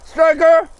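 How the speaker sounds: high, loud call across the water. Real, not acted. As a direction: shout over to your buddy. Calling out to his friend.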